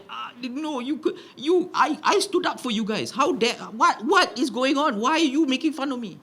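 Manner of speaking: acted wounded disbelief, faltering; heartbroken child. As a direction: plead as a betrayed little boy. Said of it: "it broke my heart".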